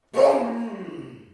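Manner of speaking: a loud, sudden gunshot boom; rifle boom. Acted. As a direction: bark out a loud rifle shot. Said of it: "when they heard a sound like this".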